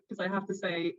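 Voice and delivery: ordinary delivery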